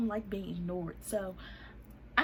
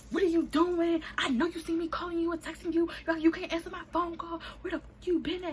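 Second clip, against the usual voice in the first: high, raised-voice demanding questions; angry confrontation; 9 semitones higher, 4 dB louder than usual. Retold or imitated. Confronting him for ignoring calls, high angry tirade. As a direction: lay into them, pitched up high.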